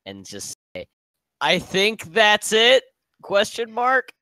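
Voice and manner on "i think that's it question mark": high shouted question; yelling. Acted. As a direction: yell it up across the sky. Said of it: "I'm gonna yell at Umbar".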